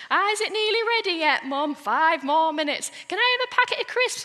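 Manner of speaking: put-on high, quick kid's pleading; pestering child. Hungry child asking Mum for crisps, high pestering voice.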